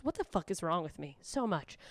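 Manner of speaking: ordinary delivery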